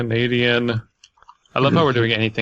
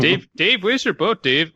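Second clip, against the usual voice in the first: calling out to Dave; 6 semitones higher than usual. Acted. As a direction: call to him out at sea.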